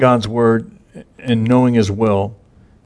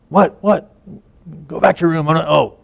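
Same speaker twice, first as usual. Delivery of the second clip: much higher, exasperated sleepy priest; groggy annoyance; 6 semitones higher than usual. Retold or imitated. Old priest Eli roused twice by Samuel, higher and exasperated. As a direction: snap at the boy, woken a second time.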